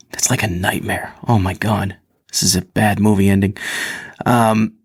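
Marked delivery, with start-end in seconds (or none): none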